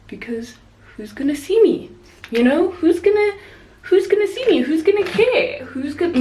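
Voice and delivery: mock exasperated inner voice. To herself about skipping full makeup, a mock exasperated rising whine. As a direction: ham up the 'who cares' shrug, climbing in pitch. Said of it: "so I was like".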